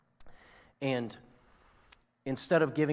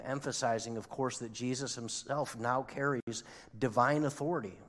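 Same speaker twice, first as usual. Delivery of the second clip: usual speaking voice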